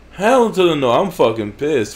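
high, loud, furious outburst; angry gamer yelling at the screen; raging yell. Real, not acted. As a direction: shout it at the game in disbelief. Still raging.

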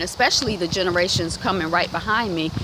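usual speaking voice

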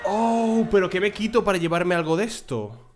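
excited discovery. Real, not acted. Spots an item he wants.

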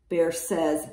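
ordinary delivery

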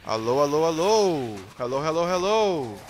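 loud hailing with drawn-out rising swells; calling out. Real, not acted. Gamer trying to reach other players, calling out. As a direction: holler hello to someone across a distance.

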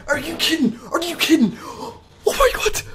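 high, hushed squeak of disbelief; soft and high, as if the voice has gone from shock; breathless disbelief. Real, not acted. Streamer overwhelmed by a rare find, breathless disbelief.

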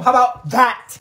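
loud, high defiant retort. Acted. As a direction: throw it at her. Teacher snapping back.

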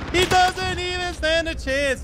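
drawn-out high squeal of glee; high-pitched gloating. Real, not acted. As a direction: crow it slowly in a sustained falsetto. Gloating repeat over a helpless opponent.

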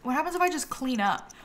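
ordinary talk, nothing marked

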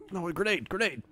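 high urgent callout of grenade; grenade warning yell. Real, not acted. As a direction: call out the grenade, alarmed. Warning teammate mid-game.

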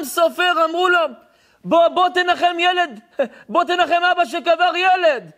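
high, loud, relentless sermon cry; preaching at full force. Acted. Preacher crying out the Chatam Sofer story to listeners. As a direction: preach it out at the top of the voice.

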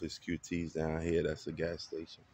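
ordinary talk

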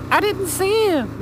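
high, slow whiny excuse voice; mocking the driver's excuse. Vlogger mocking the careless driver's excuse, whiny high voice. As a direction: mimic the careless driver pleading innocence. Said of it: "I hope it's not a cyclist".

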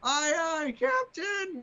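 put-on high, loud kid chorus voice; high kids' shout. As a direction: shout back like eager kids. Kids' reply in intro.

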